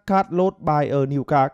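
"buy" with no final s; dropped final s. Shown as a demonstration. Teacher mimicking the common mistake, no s. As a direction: drop the ending of "buy" like careless learners. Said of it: "chúng ta".

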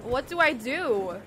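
normal talking voice

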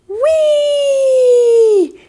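high squealing whee on the swing; gleeful whee. A narrator's reading. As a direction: squeal with joy going up. Robot swinging in the park.

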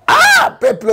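sudden high shout of despair; exasperated cry. Real, not acted. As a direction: throw it out at the people in frustration. Outburst addressing the people.

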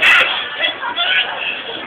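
a sudden high hammed-up wail; shrieking wail. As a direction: burst into a mock anguished shriek. Imitates her wailing cry.

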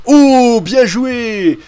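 loud, drawn-out cheer for a great play; exclamation enthousiaste. Real, not acted. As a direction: cry out in real excitement, stretching it. Réagit à un beau panier. (exclamation enthousiaste, forte et traînante)

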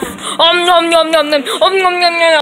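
om nom nom gobbling noises; greedy smacking while eating. Pretend. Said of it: "by swallowing you".

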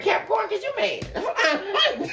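high, laughing half-shout; playful fake anger breaking into laughter; mock angry laugh. Real, not acted. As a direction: laugh while pretending to be mad. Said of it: "Shoot, I'm getting angry".

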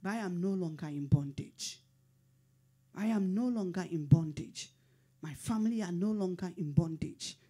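steady chanted affirmation; calm repeated confession of faith; prayerful declaration. Acted.

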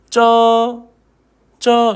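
쩌 held long, tense j; sustained 쩌 with a hard ㅉ onset; pronunciation drill. Shown as a demonstration. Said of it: "한 번씩 읽어볼까요?".

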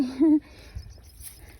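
short amused laugh. Real, not acted. Vlogger amused at packing the bed full, short laugh. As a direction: laugh briefly at your own remark. Jokes about overstuffing the spawn.

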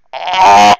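high, very loud drawn-out cry; shocked yell. Real, not acted. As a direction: let out a full-force real scream. Reader yells in shock.

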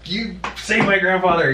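re-enacted desperate demand, high and loud; retold yelling. Grandson demanding doctors save his grandfather, retold yelling. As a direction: shout it as an order to the doctors. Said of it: "I was yelling at people".

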